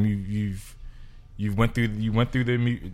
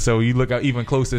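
ordinary talk, nothing marked

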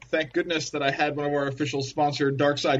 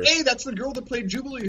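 excited star spotting; 11 semitones higher than usual. Pretend. Spotting stars on red carpet.

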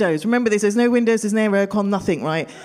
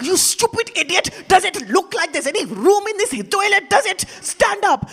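scolding grandmother; 10 semitones higher than usual. Retold or imitated. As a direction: tear into him like a fierce grandmother. Grandmother slaps and scolds uncle.

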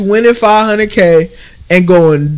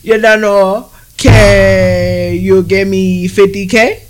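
put-on Caribbean pop-star accent, drawn out; much slower than usual. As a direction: do a slow, teasing imitation of a singer's island accent. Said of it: "in her little accent".

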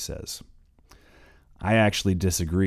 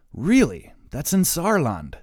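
surprised; 9 semitones higher, 5 dB louder than usual. Acted. Surprised reaction to hometown.

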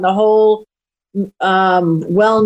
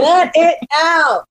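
higher drawn-out saying; 7 semitones higher, slower than usual. Retold or imitated. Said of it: "as they say".

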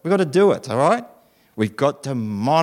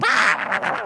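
mock argument noise.

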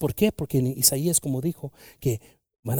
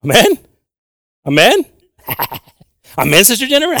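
call for amen; 9 semitones higher, 10 dB louder than usual. Real, not acted. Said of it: "calling for response".

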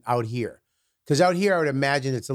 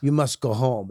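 lower, stern Russian superintendent; Russian accent; 3 semitones lower than usual. Retold or imitated. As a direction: scold in a put-on Russian accent. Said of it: "She was Russian".

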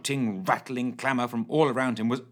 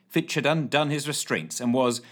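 plain reading voice

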